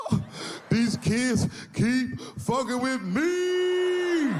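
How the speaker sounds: high, slow wailing sob; hammed-up crying rap star; mock crying. Son crying to his dad like [name], slow high wail. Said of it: "started crying like he was [name]".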